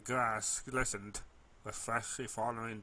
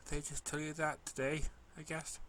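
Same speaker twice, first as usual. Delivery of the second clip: ordinary delivery, nothing marked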